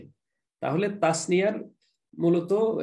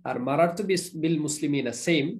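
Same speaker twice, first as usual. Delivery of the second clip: normal talking voice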